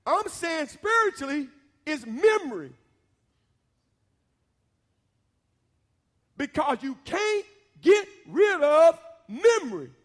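loud, high and slow, stretching the big words; fiery preacher's climax; preaching at full force. Acted. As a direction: preach it down with rising fire. Preacher drives home the lesson.

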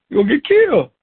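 high, loud urgent warning; parent warning. Retold or imitated. Parent warning child about road.

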